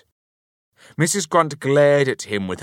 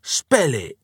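sudden, higher-pitched blurt; 5 semitones higher, 4 dB louder than usual. A narrator's reading. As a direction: blurt it out as an abrupt challenge. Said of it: "Mister Grunt blurted out".

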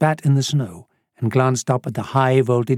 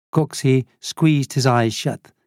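ordinary delivery

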